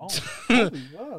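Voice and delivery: high, loud fake wonder; mock excited gasp. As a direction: cheer like seeing Kobe. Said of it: "I'll be like".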